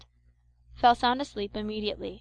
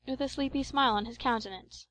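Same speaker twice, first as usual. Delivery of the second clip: ordinary delivery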